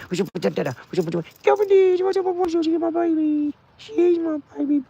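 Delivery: very high squeaky dog greeting. Real, not acted. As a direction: squeal hello to the puppy. Greeting dogs at home.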